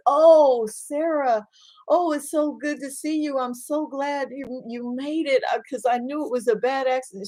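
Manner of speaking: high, bright-eyed relief at seeing a friend; hospital patient glad to see her friend, happy and high; cheerful patient greeting. Acted.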